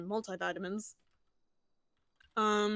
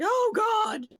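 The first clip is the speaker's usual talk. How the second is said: high, loud cry of panic; retold scream; 13 semitones higher, 10 dB louder than usual. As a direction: yell it out in a panic. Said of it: "he was screaming something like".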